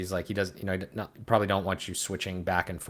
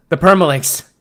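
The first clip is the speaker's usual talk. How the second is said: loud, high outburst of dismay; yelled in alarm; exasperated shout; 9 semitones higher, 14 dB louder than usual. Real, not acted. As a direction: cry it out as if disaster has struck. Outburst over permalinks.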